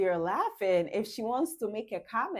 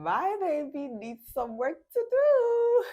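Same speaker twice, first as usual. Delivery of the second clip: playful laughing tease; 8 semitones higher, 5 dB louder than usual. Acted. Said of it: "And then she's laughing".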